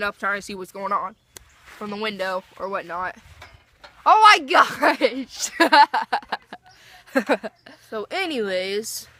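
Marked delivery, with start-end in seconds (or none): 4.1-5.5 loud, high, drawn-out exclamation; startled outcry. Real, not acted. Interrupted by dogs fighting. 7.1-7.7 short burst of laughter. Real, not acted. Laughs after her outcry.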